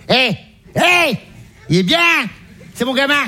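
high, proud mum calling out; mother's voice. Acted. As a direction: boast about your son in a high mum voice. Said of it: "on lui donne un coup de coude".

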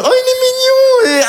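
very high, drawn-out cutesy squeal; mock adoring coo. Torn man reacting to a baby reaching out, high cooing. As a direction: gush over a cute baby in a squeaky stretched voice. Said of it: "je suis partagé entre".

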